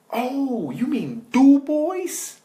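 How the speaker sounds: very high, loud, drawn-out caricature; hammed-up local suddenly catching on; American biker correcting. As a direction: stretch DU BOIS out as "doo-boys". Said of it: "And they're like".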